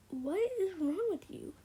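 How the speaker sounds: high-pitched, acted exasperation; exasperated retort. Retold argument with the girl.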